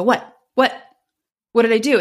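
acting out getting worked up. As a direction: protest as if wrongly accused. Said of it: "I'm getting worked up".

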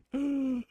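sharp, high intake of breath; shocked gasp. Retold or imitated. Patient reenacting seeing his heart pictures, shocked gasp. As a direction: react with a sudden gasp.

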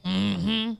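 low throaty character grunt; Sling Blade. Acted. Cohost asking caller about meeting the actor, Sling Blade impression. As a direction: hum it as a film-character impression. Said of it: "That was my Sling Blade impression".

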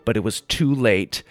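ordinary delivery

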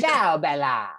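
laughing fake Italian shout; hammy faux Italian; Italian accent. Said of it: "[name] loves to be faux Italian".

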